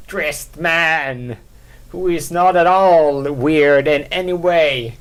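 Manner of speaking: slow, drawn-out enticing call; luring voice. Pretend. Podcaster luring viewers in, slow and coaxing. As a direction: beckon them in slowly. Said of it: "to draw everyone in and be like".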